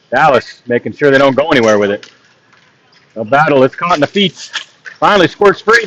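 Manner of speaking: steady running hockey commentary; live play-by-play. Acted. Commentator calling the game for stream viewers, live play-by-play. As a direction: call the puck movement as it happens.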